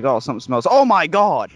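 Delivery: acting out the friend's shock at the kitchen. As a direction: voice jumps high and loud on oh my god. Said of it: "He's like".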